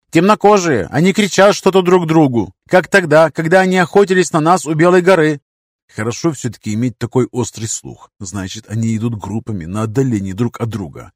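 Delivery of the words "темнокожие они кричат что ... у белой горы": loud, high alarmed warning; urgent warning shout. Acted. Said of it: "предостерегающе поднял руку".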